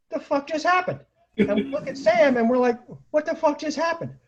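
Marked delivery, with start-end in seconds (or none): 0.1-1.0 high-pitched baffled question; stunned disbelief. Retold or imitated. Squad stunned after car leaves. 3.1-4.1 high, baffled repeat of the question; stunned disbelief. Retold or imitated. Squad stunned after car leaves.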